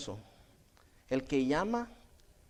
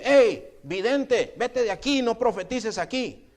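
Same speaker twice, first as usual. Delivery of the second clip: high, loud acted command; shouted dismissal; 6 semitones higher, 6 dB louder, slower than usual. Priest orders the prophet to leave, shouted dismissal. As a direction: call out sharply to send someone off.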